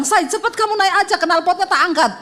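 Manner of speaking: a high, hurried call to her husband; urgent call. Retold or imitated. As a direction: tell him to hurry, in a rush. Urging husband on the ramp. (istri menyuruh suami cepat naik, mendesak)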